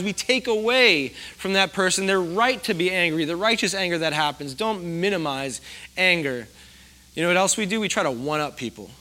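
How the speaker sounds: usual speaking voice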